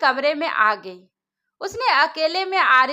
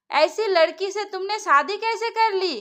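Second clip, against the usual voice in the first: plain reading voice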